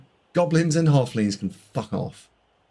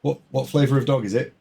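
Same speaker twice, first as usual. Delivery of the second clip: ordinary delivery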